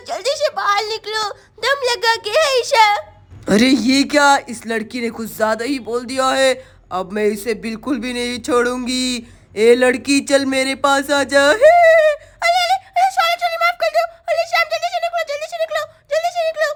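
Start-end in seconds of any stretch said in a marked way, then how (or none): 3.5-11.4 much lower, menacing witch; angry witch voice. Acted. Witch angered by the girl. 11.4-15.8 put-on much higher, frightened girl begging; scared pleading. Girl panics as witch grabs.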